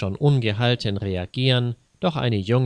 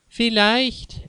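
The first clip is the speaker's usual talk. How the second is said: much higher mock whiny voice; mocking imitation; 12 semitones higher than usual. Maffei mocks Jonas's words in a much higher squeaky voice. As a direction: ape the boy by squeaking it up high. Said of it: "äffte Herr Maffei ihn nach".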